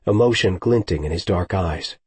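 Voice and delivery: ordinary delivery, nothing marked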